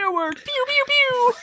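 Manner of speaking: very high squeaky pew-pew noises; mocking fireworks imitation. Host ridicules Jubilee's fireworks power, high mock sound effects. Mimics silly fireworks superpower.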